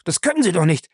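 high, panicked protesting; frightened pleading at a very high pitch; horrified cry. A narrator's reading. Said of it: "schrie Lacey entsetzt auf".

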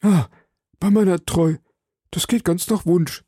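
high-pitched, pleased outburst; eager exclamation. A narrator's reading. Bonacieux welcomes a confrontation, exclaiming eagerly. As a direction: cry out in eager relief. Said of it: "rief Bonacieux".

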